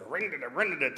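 rising-and-falling putt-putt engine noise; sing-song vocal moped sound effect; imitated moped engine. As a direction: mimic a little moped revving up. Bunny's moped starting up.

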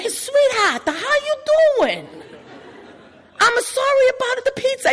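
put-on high, sing-song Italian-American accent; a cheery Italian pizza man with a laugh; Italian guy impression. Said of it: "cute little Italian guy that calls everybody sweetheart".

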